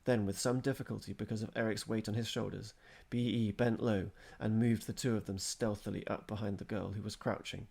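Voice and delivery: ordinary delivery, nothing marked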